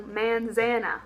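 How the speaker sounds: manzana in a mock East Texas drawl, man-ZAN-uh; deliberately bad East Texas accent. Shown as a demonstration. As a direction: mangle manzana like an East Texan. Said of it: "a really bad East Texas pronunciation of this word".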